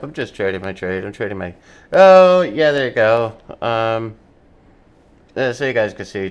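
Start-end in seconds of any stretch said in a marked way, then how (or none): 1.9-3.4 much louder, higher cheer; excited cheer. Real, not acted. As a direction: celebrate the winning trade. Trade goes his way.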